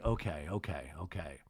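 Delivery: low, trailing mutter; Whitney. Retold or imitated. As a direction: imitate him grudgingly letting it drop, softly. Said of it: "Whitney's like".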